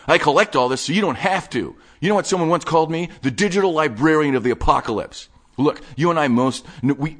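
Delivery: breathless nerdy enthusiast showing off his stash; manic collector Wade. Acted. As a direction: rattle it off like an excited hoarder. Wade again.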